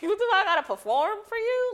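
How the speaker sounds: high, loud laughing indignation; laughing outrage. Real, not acted. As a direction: laugh it out in disbelief.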